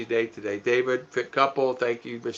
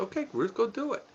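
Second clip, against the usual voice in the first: lighter and higher, softly encouraging; sweet higher voice to wife; 9 semitones higher, 9 dB quieter than usual. Retold or imitated. Husband sending his wife off to do the errand, higher and soft. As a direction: coax her gently to go, pitched up.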